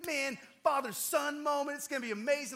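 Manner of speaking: celebrating. Retold or imitated. Proud dad reliving first deer with son, excited. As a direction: savor the big moment out loud. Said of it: "we're, like, celebrating".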